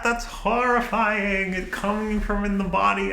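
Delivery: high, slow squeamish scolding voice, half laughing; mock disgusted critic. Her inner critic scolding her channel, high squeamish disgust. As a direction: play a prissy censor horrified by snot.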